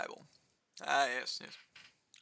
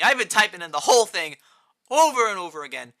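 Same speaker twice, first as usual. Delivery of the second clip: exasperated; 5 semitones higher, 8 dB louder than usual. Real, not acted. Frustrated at wasted typing.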